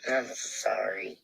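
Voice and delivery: slow, hushed whisper of sympathy; a gentle whispered sorry; soft whisper. Real, not acted. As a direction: breathe the apology quietly.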